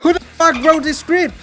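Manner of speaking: loud high annoyed yell; furious at the code; angry outburst. Acted.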